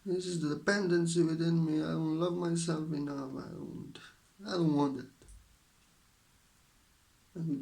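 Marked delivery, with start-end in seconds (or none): 0.0-5.0 much higher and louder, anguished inner voice; mock distressed self-talk; inner realization voiced. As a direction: blurt out the painful realization. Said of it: "so that we realize".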